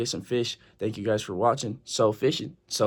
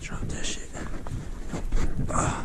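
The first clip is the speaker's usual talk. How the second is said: tense whisper while landing fish; hushed, urgent whisper; 6 dB quieter than usual. Real, not acted. Tense moment fighting the fish.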